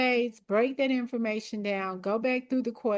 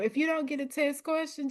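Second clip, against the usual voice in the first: ordinary talk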